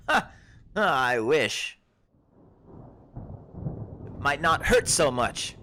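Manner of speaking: put-on high, loud, surprised old herbalist; a startled forager shooing travellers back; herb-picking woman. Herbalist warning the lost adventurers, high and loud. GM voices Ashleen.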